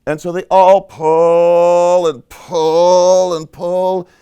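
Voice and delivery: very loud, slow, stretched-out pulls; straining heave. A narrator's reading. Storyteller has all animals pull, loud straining. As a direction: bellow each "pull" long, as if hauling.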